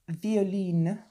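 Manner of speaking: colourway name in a put-on French accent; best French accent. Shown as a demonstration. Knitter announces the colourway to viewers, French accent. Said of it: "That's my best French accent".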